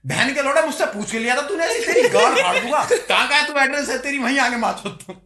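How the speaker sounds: high, very loud re-enacted tirade; furious comeback. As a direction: yell threats in a comic retelling of rage. Acts out his cursing retort. (गुस्से में चिल्लाकर धमकाने का अभिनय)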